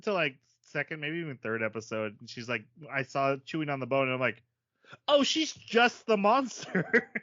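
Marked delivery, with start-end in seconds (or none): 5.1-7.1 loud, high, slow dawning realization; mock amazement, pitched way up; sudden realization. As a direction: let the penny drop, slowly and loudly. Acts out own belated realisation.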